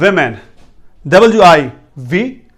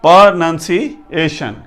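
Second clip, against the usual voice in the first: slow pronunciation, broken into parts; exact pronunciation; slower than usual. Shown as a demonstration. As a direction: draw out pronunciation as per-nun-si, ay-shun. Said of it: "ये इसका exact".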